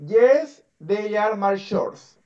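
slow, stretched-out model sentence; repeat-after-me model. Shown as a demonstration. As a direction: say it slowly for a child to repeat. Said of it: "repite después de mí".

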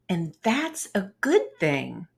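plain reading voice

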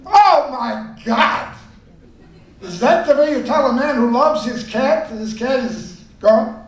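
mock outrage. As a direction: wail it like a wronged brother. Brother outraged at the news.

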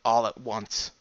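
ordinary delivery, nothing marked

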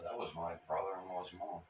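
usual speaking voice

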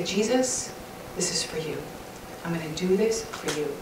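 whispered prayer offered to Jesus; quiet prayer. Shown as a demonstration. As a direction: hush it like a private prayer.

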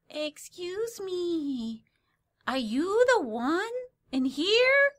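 put-on high, slow old-lady voice with Eastern European accent; drawn-out, high, hammed-up Eastern European accent; muddled Eastern European accent. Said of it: "I don't know what kind of accent I'm doing".

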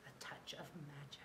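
soft, low near-whisper; hushed title. A narrator's reading. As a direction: breathe the title mysteriously. Reads chapter title in a hush.

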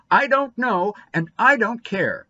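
loud, high, defiant outburst; fierce. A narrator's reading. Boy goaded to desperation turns on Dora, fierce. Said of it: "turned fiercely".

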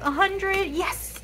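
high-pitched, happy celebration; real delight, voice lifting high. Real, not acted. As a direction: celebrate hitting the total, pitch jumping up. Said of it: "I'm so happy".

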